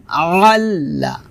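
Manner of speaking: ordinary talk, nothing marked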